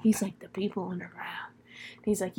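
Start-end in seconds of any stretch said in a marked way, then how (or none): 0.4-1.5 very soft conspiratorial whisper; hushed whisper. Retold or imitated. Host as Ezra revealing who he hears, acted whisper. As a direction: barely voice it, like a secret.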